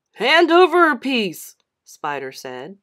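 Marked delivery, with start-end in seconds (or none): 0.1-1.6 loud, high demand; bully demanding the candy. Acted. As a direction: order him to hand it over.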